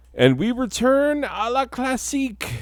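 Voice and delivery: very high, held falsetto announcement; sing-song up high with pretend French grandeur; mock-fancy falsetto. Mock-grand French slide transition.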